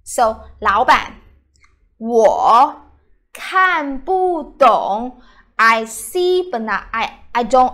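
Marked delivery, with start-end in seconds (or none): 2.0-5.2 slow. Shown as a demonstration. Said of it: "teacher models phrase slowly".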